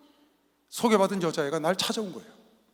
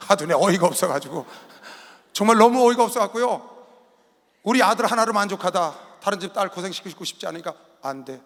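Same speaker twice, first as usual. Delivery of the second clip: normal talking voice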